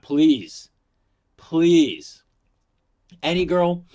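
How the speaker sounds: high, loud joking plea; pleading. Real, not acted. Asking women to do background checks, loud pleading.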